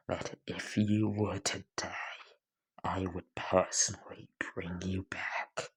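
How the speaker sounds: measured menace, each phrase set apart; cold, menacing character. Acted. Izuku vowing endless torment to Bakugo, coldly menacing.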